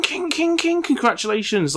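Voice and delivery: high sing-song payout chime; slot machine jingle. Retold or imitated. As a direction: imitate a slot machine win. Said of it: "gambling website sound effects".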